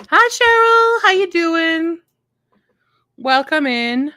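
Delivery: cheery greeting. Real, not acted. Greeting a chat viewer.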